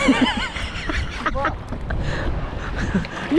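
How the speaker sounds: long, voiceless laughter; laughing. Real, not acted. Fisherman amused during the haul, voiceless laughter. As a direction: laugh mostly on breath.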